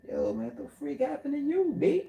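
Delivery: mocking a voice that lost its bass; mock high voice. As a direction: squeak it up like a voice gone high.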